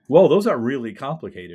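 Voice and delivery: high, loud retold amazement; amazed whoa. Young man amazed by a typewriter, loud surprise. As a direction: marvel out loud at the typewriter's insides.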